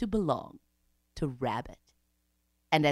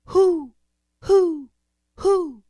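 high, loud, falling hoots; owl calling out; 12 semitones higher, 10 dB louder, much slower than usual. A narrator's reading. Mother Owl calls up the sun, hooting. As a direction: hoot three spaced calls. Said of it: "and calls out".

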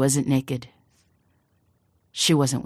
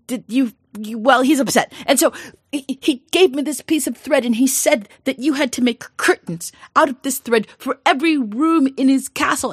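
flustered father; 9 semitones higher, 5 dB louder than usual. Acted. Frantic father explains.

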